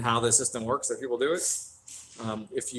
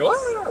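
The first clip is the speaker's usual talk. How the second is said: high-pitched mock scream; joking scream; 5 dB louder than usual. Shown as a demonstration. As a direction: let out a jokey, high scream of fright. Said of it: "started jokingly screaming about shit".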